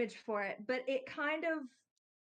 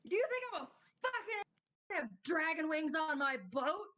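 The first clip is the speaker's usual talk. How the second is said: indignant; 6 semitones higher than usual. Acted. Captain's indignant retort.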